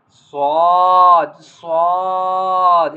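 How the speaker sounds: "Swad" loud with a long full vowel; bold pronunciation. Shown as a demonstration. Teacher showing pupils a bold letter, heavy drawn-out "Swad". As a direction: hit "Swad" heavily and hold the vowel. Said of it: "It is a bold".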